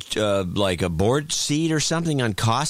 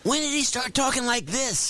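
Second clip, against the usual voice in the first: higher, put-on folksy politician voice; George Bush imitation; 9 semitones higher than usual. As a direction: mimic the president's folksy talk, pitched up. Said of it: "now he talks like this".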